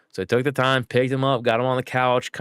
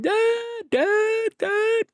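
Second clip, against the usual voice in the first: put-on much higher whining child calling Dad; mock a little kid wailing for his father; crying toddler calling; much slower than usual. Son following dad round the house, high whiny calls. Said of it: "follow you around the house crying".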